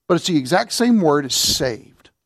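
usual speaking voice

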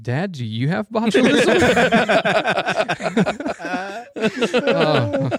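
laughing out the son's question; laughing through quote. Real, not acted. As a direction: quote cracks into a high laugh. Kid's question retold, laughing.

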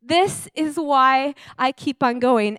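imitating a weeping preacher. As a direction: choke it out through tears. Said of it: "he just wept and broke down".

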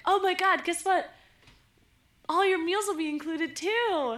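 high excited sales pitch; telemarketer voice. Retold or imitated. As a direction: gush like a pushy cruise seller. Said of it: "they were like".

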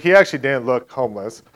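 ordinary delivery

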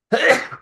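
sneezing. Real, not acted. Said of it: "excuse me, sneeze".

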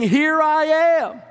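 drawn-out preacher's cry; proclaiming. Acted. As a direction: ring it out to the crowd. Preacher voicing God's declaration.

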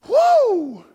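loud high "whoa"; exultant high holler; elated whoop. Real, not acted. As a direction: whoop with joy from the pulpit. Preacher getting happy.

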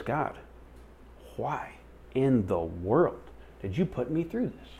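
high, soft, drawn-out complaint; slow, halting mock anguish; exasperated pleading. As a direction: question God in pained disbelief. Retold exasperated complaint to God.

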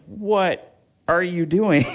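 slow, high incredulous question; startled disbelief. Retold or imitated. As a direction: draw it out, aghast at the sudden yell. Startled uncle reacts to yelling.

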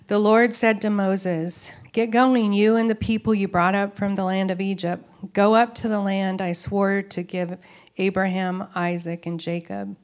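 reading scripture aloud with weight. Acted. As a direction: read the Lord's words out, firm and clear.

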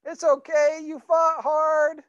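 put-on high, gentle consoling of a tearful boy; comforting his little son. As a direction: soothe him, coax him to buck up. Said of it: "I said".

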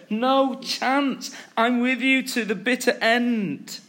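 high, emphatic acted pledge; impassioned, insistent promise; adamant vow. Peter vows loyalty to Jesus.

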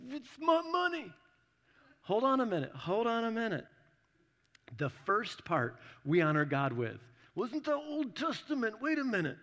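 high, put-upon voice of a startled listener; mock indignant churchgoer. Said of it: "and you're just like".

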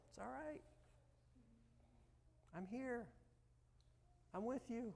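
calm someone in a storm, gently; tender reassurance. Acted. Jesus in the rocking boat calming you, tenderly.

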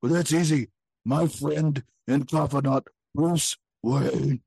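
normal talking voice

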